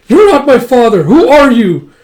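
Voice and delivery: high, loud accusing shout; acted outraged confrontation. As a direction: cry out at an impostor, acted. Child confronts an impostor father.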